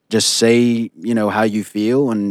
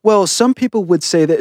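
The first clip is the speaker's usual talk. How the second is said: higher-pitched mocking imitation of hedged disagreement; passive-aggressive; 8 semitones higher than usual. Host mimicking a co-host's hedged disagreement, passive-aggressive. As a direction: hide the dig behind a high, innocent voice. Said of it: "passive aggressive".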